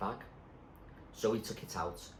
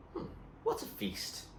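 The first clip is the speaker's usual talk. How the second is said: breathy, higher, slow mock delight; wry smiling remark; 4 dB quieter than usual. Munchkin joking about the tins, smiling and slow. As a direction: savour the joke with a smile. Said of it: "said Munchkin, smiling".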